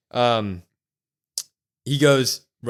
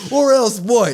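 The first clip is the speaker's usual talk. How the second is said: shouting at top of lungs; 10 semitones higher, 9 dB louder than usual. Retold or imitated. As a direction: bellow it out as a furious dare. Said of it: "The top of his lungs".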